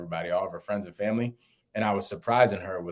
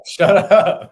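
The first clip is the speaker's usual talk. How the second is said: mock shout; 9 semitones higher, 13 dB louder than usual. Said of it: "I'm like".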